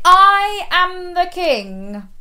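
slow, loud, high regal proclamation; big storybook king voice. Pretend. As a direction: declare it grandly like a crowned king. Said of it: "put your king crown on your head and say".

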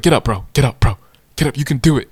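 loud, punchy coach pep talk; cheering football coach. Retold or imitated. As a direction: imitate a coach urging a player up. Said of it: "like a football coach trying to, like, cheer me on".

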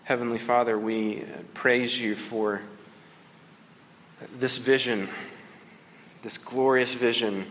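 unhurried, reverent prayer voice. Acted. Preacher praying over his congregation, reverent and unhurried. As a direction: pray humbly before the congregation.

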